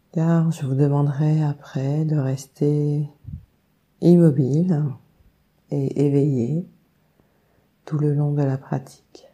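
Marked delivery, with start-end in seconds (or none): none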